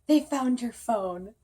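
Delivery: mock-solemn dad delivering great news; dad's announcement. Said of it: "he's like".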